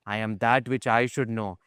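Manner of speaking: usual speaking voice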